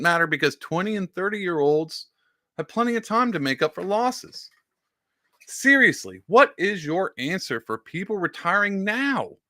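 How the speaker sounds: high pitched sarcastic reading of a complaint; mock outraged tweeter; whiny mock tweet voice. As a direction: read it high and whiny, mocking the poster. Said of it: "This is old Timmy Wise".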